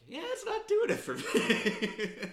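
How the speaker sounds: high, loud, laughing mock verdict; mock dismissive quote. Host acting out own reaction to the album, mock dismissive.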